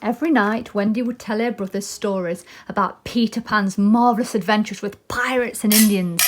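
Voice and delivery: ordinary delivery, nothing marked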